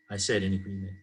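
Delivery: ordinary delivery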